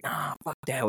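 retold Canadian-accented refusal. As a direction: brush it off with a light Canadian accent. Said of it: "with this, like, Canadian accent".

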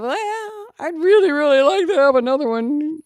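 high, loud mock plea; high, loud, pleading quote; hammed-up pleading. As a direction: act out her younger self begging. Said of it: "And I said".

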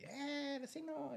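high, cheery neighbor calling out; friendly neighbor calling. Retold or imitated. Neighbor calling him over.

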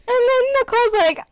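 laughing through the words, fast; can barely speak for laughing. Real, not acted. Laughing fit over dog story.

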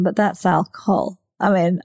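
normal talking voice

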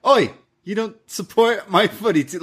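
high-pitched imitation of an angry football fan; mock angry. Host imitating wrestler walking out angry, mock indignation. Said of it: "he comes out here all angry".

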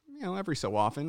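ordinary talk

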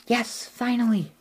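put-on high, breathy child's voice; little ghost girl. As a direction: speak as a small, shy ghost girl.

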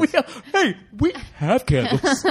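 high, slow, laughing mockery. Real, not acted. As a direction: laugh while echoing him. Mocks line while laughing.

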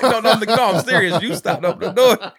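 high, loud, laughing no no; loud laughing plea. Retold or imitated. Uncle reenacting his plea to the niece, loud and laughing. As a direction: yell it while cracking up.

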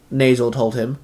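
ordinary delivery, nothing marked

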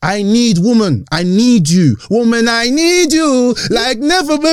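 slow, high, dramatic longing; hammed-up pleading. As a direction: plead like a lovesick crooner. Hammed-up serenade to host.

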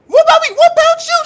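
high, loud imitated yell back; angry retort. The lady yelling back at mom, high mock yell. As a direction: hurl it back like a mad stranger. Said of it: "she said, she said".

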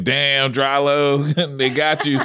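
high, teasing chuckle through the words; laughing taunt. Retold or imitated. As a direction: rib a buddy while cracking up. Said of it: "I'm just laughing".

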